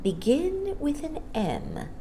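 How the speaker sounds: soft, slow hushed question; soft whisper. Acted. Mary Poppins to the child, slow hushed question. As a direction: draw the question out softly. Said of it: "she whispered".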